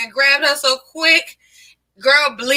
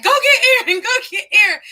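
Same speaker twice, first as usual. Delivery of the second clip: much higher, louder pleading call; urgent calling out; 10 semitones higher, 4 dB louder than usual. Retold or imitated. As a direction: beg someone to fetch Aaron. Calling for cousin mid-fight.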